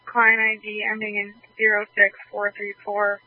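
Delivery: ordinary talk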